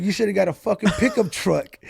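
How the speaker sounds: an exasperated father setting his son straight; scolding dad. Retold or imitated. Dad telling his son he should've bought a truck, loud scolding. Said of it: "He's like".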